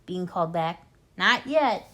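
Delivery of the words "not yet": shout. A narrator's reading. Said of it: "shouted Bogus".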